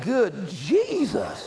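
high, drawn-out mock exclamation; mock shock. Someone hearing relatives talk wild and raunchy, in mock shock. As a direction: stretch it out in comic disbelief.

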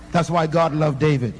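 ordinary talk

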